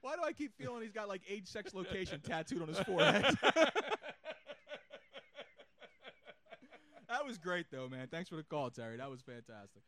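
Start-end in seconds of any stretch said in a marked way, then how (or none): 3.3-7.0 long helpless laughing fit. Real, not acted. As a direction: laugh hard for a while. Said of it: "I can't stop laughing".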